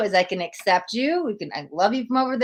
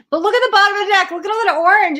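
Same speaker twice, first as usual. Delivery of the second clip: much higher and louder, fast; excited outburst; 11 semitones higher, 10 dB louder, much faster than usual. Real, not acted. Reader spotting orange in the deck, excited. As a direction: blurt it out in delight.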